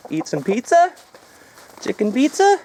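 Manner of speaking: high playful voice offering the hens pizza; baby talk to chickens. Pretend. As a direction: coo at the chickens like pets. Talking to the chickens.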